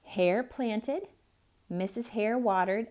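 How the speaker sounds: ordinary delivery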